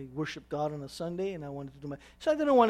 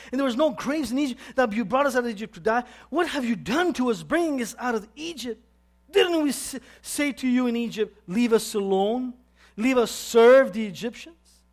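complaining; 5 semitones higher, 6 dB louder than usual. Acted. Reads the Israelites' complaint to Moses.

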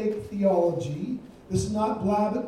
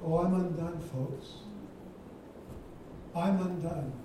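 dramatic sigh; 5 semitones lower, 9 dB quieter than usual. Acted. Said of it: "Woe is me".

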